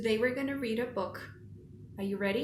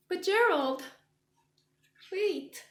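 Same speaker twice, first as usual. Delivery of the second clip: pleading; 8 semitones higher, 4 dB louder than usual. Acted. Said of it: "And Piggy says".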